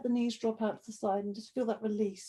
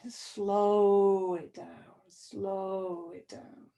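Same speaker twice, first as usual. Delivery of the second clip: drawn-out slow; much slower than usual. Real, not acted. Yoga teacher slowing students' movement and breath, very drawn out. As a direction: draw each word right out, then let it fade.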